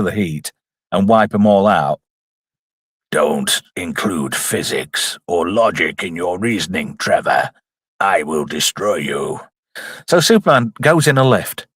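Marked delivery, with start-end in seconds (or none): 3.1-9.4 low, slow, menacing villain; mock villain threat. Villain warning a nitpicking viewer, mock menace. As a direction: drop deep and pronounce doom slowly.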